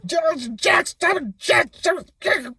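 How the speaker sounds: hurt rider shouting at his donkey; pained shout. Acted. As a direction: cry out as your leg scrapes the wall. Leg scraping against wall.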